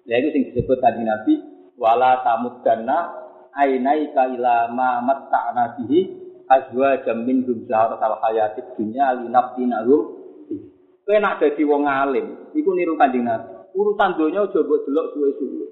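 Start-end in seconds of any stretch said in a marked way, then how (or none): 1.8-10.2 slow, low, measured recitation; Quran recitation. Acted. Preacher quoting the Prophet's verse, slow low recitation. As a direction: intone the verse slowly and reverently.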